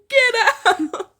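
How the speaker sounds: loud laughing get out; laughing shout. Real, not acted. Teasing a friend watching the stream, laughing shout.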